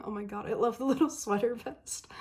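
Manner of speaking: giggling through the words; laughing. Real, not acted. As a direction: laugh while gushing. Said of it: "I started laughing".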